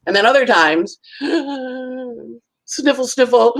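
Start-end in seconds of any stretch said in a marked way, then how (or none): 2.7-3.6 high gasps and spoken sniffles like fake sobbing; hammed-up weepy gasping; mock crying gasps. Shown as a demonstration. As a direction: act out a teary breakdown. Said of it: "where it's very emotional".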